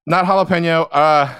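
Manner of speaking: loud, high flustered backtracking; buckling. Retold or imitated. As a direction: cave in and hastily take it back.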